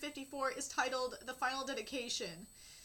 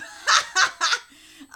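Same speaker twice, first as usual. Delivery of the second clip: a big, loud mocking laugh; loud teasing burst of laughter; 2 semitones higher, 19 dB louder than usual. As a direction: laugh right at him. Character laughs loudly at the patient.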